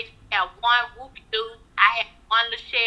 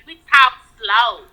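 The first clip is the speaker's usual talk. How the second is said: slow, stretched out; slow family drawl; 5 dB louder, slower than usual. Shown as a demonstration. Explains to the host her family talks slow, slowly. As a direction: take your time over each word. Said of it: "A lot of people say I talk a little slow".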